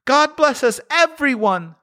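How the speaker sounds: put-on a sickly little boy blessing everyone; Tiny Tim. A narrator's reading. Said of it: "said Tiny Tim".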